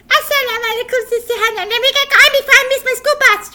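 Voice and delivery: put-on high, loud, fast puppet boy; rushing puppet. Puppet boy rushes for the bus, high and fast. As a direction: hurry out the door.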